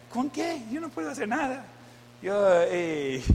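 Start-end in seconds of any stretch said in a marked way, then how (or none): 0.1-1.7 high, soft playing-innocent voice; mock clueless husband. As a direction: play the clueless husband, pitched up. Husband retells refusing to help. (marido despistado imitado, voz aguda y suave)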